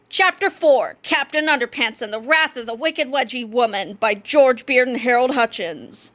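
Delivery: ordinary delivery, nothing marked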